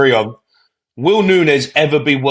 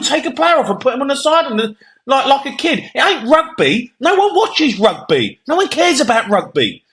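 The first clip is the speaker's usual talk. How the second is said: furious rant; 8 semitones higher than usual. Real, not acted. Pundit ranting to co-host about sin bins, furious and high. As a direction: tear into the rule like it's an insult.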